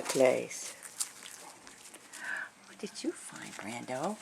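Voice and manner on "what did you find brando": soft whispered question; whispering. Real, not acted. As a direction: whisper it to the dog. Whispering to the dog.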